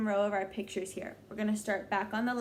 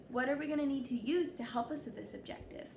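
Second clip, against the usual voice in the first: ordinary talk, nothing marked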